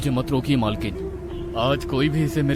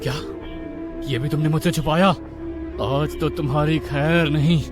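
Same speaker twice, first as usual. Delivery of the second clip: angry husband's put-on voice; threatening husband; 3 semitones lower than usual. A narrator's reading. Husband learns of wife's hidden past, threatening. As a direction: threaten her as the betrayed husband.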